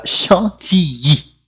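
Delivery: slow "chantilly" as "shan-ti-yi"; drawn-out French "chantilly", no L; French pronunciation. Shown as a demonstration. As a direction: spell out "chantilly" by syllables, LL as y. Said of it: "a pronúncia correta aqui em francês".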